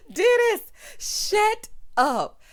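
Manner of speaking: loud, high holler straight out of a cackle; high-pitched mock telling-off; laughing scold. Real, not acted. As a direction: yell it in amused disbelief, still cracking up. Scolds Dennis through laughter.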